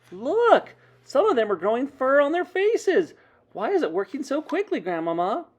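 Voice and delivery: put-on loud, high, thrilled child; excited cry. A narrator's reading. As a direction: call out in amazement to Grandmama. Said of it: "I cried".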